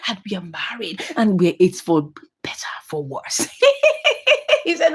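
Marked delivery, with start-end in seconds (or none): 0.1-3.5 low, hushed, halting vow; mock-solemn vow. Wife swearing loyalty to her husband before laughing, hushed and mock-solemn. 3.5-4.6 high-pitched tittering laugh; high giggle. Real, not acted. Storyteller laughing at her own mock vow, high giggle.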